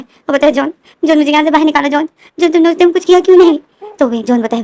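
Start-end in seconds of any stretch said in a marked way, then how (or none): 0.5-3.6 pleading through tears, louder and higher; begging to be rescued; crying. A narrator's reading. Wing crying to John on the phone, pleading. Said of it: "wing जो रो रहा होता है".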